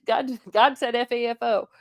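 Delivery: laughing through the punchline; giggling the words out. Real, not acted.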